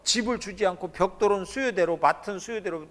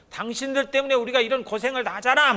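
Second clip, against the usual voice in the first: higher, louder resentful blaming; mock blame; 6 semitones higher, 3 dB louder than usual. Israelites blaming Moses and Aaron, mock angry. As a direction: throw the blame at them, annoyed. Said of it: "막 이런 거예요".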